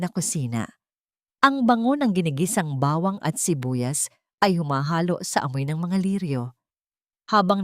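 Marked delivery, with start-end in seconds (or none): none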